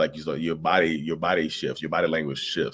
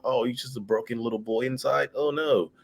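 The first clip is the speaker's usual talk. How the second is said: mock pity; 6 semitones higher than usual. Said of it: "It's like".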